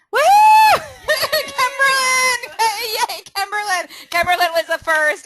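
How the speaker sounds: high, loud whooping celebration; thrilled, high-pitched shout with laughter; excited cheer. Real, not acted. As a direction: cheer the quiz winner with a big whoop. Cheering a correct quiz answer.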